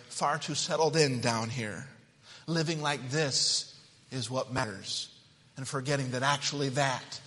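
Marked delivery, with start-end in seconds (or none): none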